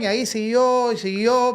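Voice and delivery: ordinary talk, nothing marked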